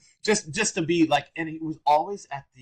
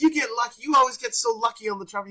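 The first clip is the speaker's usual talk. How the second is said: higher, louder teasing mock; loud crazy Gary; 11 semitones higher, 4 dB louder, faster than usual. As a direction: rib your friend loudly. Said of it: "to be loud and crazy and funny".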